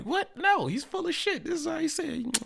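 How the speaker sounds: very high, squeaky mock protest; acted outraged friend. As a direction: voice way up in comic disbelief. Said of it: "You're just like".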